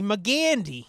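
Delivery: "McGandy" said to rhyme with Dandy; "McGandy", stressed GAN like Dandy; rhyming mispronunciation. Shown as a demonstration. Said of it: "changing the pronunciation so it rhymes with Jim Dandy".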